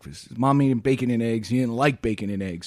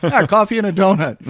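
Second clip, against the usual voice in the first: laughing through the words, louder; 5 semitones higher, 5 dB louder than usual. Retold or imitated. Kid laughing as he repeats to teacher. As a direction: giggle while repeating the answer.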